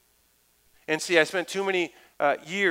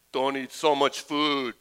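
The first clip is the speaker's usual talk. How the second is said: slow dismissive Arnold; slower than usual. Retold or imitated. Arnold answering with cigar out.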